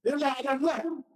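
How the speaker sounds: voiced with a chuckle, lightly playful; laughing as he repeats the line; Jesus teasing with a laugh. Jesus teasing the disciples, with a light laughing voice. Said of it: "sabi ni Jesus".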